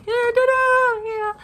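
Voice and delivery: squeaky, very high and loud critter voice; guinea pig voice. Pretend. Vlogger voicing a cartoon guinea pig, squeaky and loud. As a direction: give the cheeky guinea pig a silly squeal. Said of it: "He's like".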